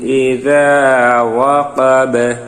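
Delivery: slow sung chant, final qaf bounced at the stop; recitation with qalqala stop. Shown as a demonstration. Teacher shows the pause on খলাক with a bounce. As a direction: chant and echo the last letter as you pause. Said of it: "জজম হয়ে যাবে এবং কলকলা উচ্চারিত হবে".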